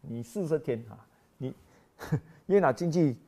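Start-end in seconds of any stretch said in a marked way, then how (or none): none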